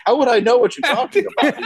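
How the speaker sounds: high, fast, mock-innocent villain voice; clueless villain. Retelling a sketch villain's reply, high and fast. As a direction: play dumb as the evil guy. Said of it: "He's like".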